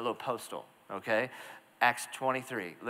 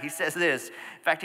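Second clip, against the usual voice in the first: usual speaking voice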